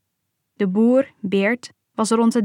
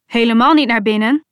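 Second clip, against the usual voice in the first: louder call, stress on niet; father's call; 7 dB louder than usual. Retold or imitated. Father calling to family that they are freed, loud firm call. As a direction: overrule the panic, call out firmly. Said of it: "riep mijn vader".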